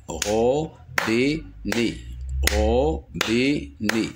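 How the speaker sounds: "robinet" slowly split into ro, bi, net; syllable by syllable. Shown as a demonstration. Teacher demonstrating three syllables, syllable by syllable. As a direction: give "robinet" three separate beats. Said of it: "trois syllabes".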